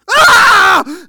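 piercing high scream of a scalding burn; pain scream. Retold or imitated. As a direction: shriek as hot tea hits the chest. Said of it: "And I'm screaming".

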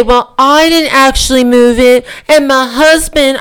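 lampoon a Georgia senator's excuse in a put-on Georgia accent; hammed-up Georgia belle accent; mock Georgia accent. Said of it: "I'll do my best Georgia accent".

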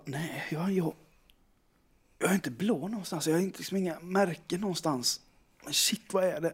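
soft, baffled muttering to yourself; examine yourself under your breath; puzzled self-talk. Retold or imitated. Man checking the mirror for bruises, quiet puzzlement.

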